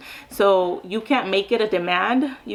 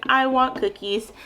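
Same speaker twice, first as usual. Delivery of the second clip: slow, deliberate model phrase; slow, clear model for a child learning to talk; 4 semitones higher, slower than usual. Shown as a demonstration. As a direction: spell it out slowly for the child. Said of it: "so I'll model that communication".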